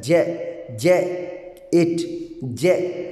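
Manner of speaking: "जे, जे, इट, जे" as separate syllables, जे stressed; stress on first syllable. Shown as a demonstration. Teacher showing the stress in jacket, syllable by syllable. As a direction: isolate जे and इट, stressing जे. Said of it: "यहाँ पर जो है वो stress है".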